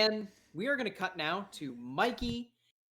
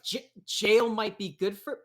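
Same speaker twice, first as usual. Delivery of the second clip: louder, higher stammered echo; indignant disbelief; 4 semitones higher, 6 dB louder than usual. Acted. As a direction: sputter it back in disbelief. Jailed daughter reacts to remark.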